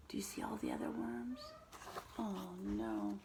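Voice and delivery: hushed, fast whisper. Acted. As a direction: whisper it like a secret. Hushed suspense while reading.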